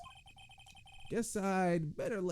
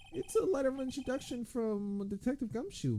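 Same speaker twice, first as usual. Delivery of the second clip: high character voice; 12 semitones higher than usual. Acted.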